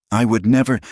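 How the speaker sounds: high, loud, shaken denial; voice shaking. A narrator's reading. Daughter denying father's accusation, voice shaking. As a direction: deny it, upset and rattled. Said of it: "her voice was shaking now".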